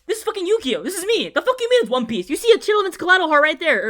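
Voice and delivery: loud, high exasperated rant; indignant outburst. Retold or imitated. Ranting at the One Piece poster, loud and indignant. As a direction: fire it back at them, offended.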